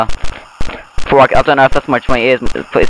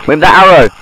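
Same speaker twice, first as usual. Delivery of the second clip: startled; 4 semitones higher, 5 dB louder than usual. Real, not acted. Startled by noise.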